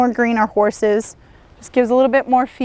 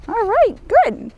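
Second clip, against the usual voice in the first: high, lifted praise to the horse; bright praise; 10 semitones higher than usual. Real, not acted. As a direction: reward him with a high, pleased call. Praising horse during lunging.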